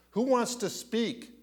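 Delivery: pitched well up, asking the crowd; high rhetorical question. Acted. Preacher asking the congregation, pitched high. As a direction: throw the question high to the room. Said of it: "It's the Lord".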